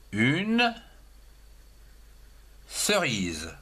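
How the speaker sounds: put-on teacher dictating une cerise to children, slow; slow dictation. Shown as a demonstration. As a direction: dictate une cerise slowly, word by word, for young pupils. Teacher dictates word for pupils.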